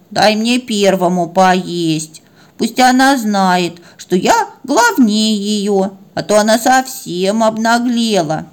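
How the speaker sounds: slow, drawn-out grumbling dog voice; sulky older dog. Acted. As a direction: drag out each word, stubborn and aggrieved. Older dog sulks, refuses to yield. (протяжное обиженное ворчание упрямого пса)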